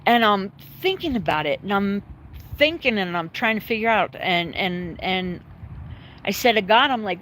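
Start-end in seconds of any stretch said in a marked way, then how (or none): none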